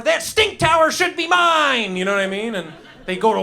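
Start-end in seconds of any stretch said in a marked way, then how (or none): 0.0-2.0 higher, louder, slow melodramatic claim; hammed-up dynasty villain. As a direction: declare it like a soap opera heir. Family dynasty fighting over tower.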